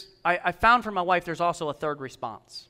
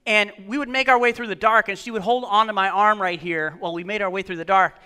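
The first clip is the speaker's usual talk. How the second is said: ordinary talk, nothing marked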